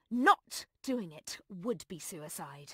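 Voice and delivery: low, slow, soft menace; quiet threat. Acted. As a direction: threaten slowly under your breath, pitch dropped. Cold threat to the man.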